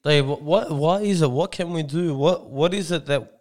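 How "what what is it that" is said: ordinary talk, nothing marked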